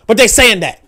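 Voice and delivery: ordinary talk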